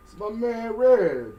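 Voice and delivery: slow booming hype intro; radio announcer voice. Pretend. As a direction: drag out the name like a DJ. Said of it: "since you got the radio voice".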